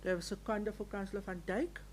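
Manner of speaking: usual speaking voice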